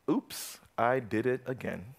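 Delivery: usual speaking voice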